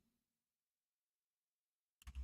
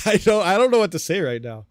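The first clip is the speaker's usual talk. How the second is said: louder, higher, laughing through the words; laughing disbelief; 5 semitones higher, 10 dB louder than usual. Real, not acted. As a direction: laugh at how crazy it is.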